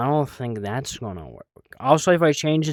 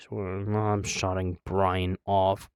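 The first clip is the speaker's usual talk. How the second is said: ordinary talk, nothing marked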